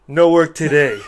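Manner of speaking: laughing quote of the movie guy; imitating the line, laughing. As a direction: toss it off through a laugh. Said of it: "He goes".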